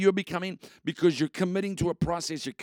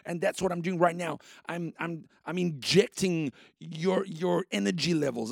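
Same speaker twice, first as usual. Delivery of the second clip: ordinary talk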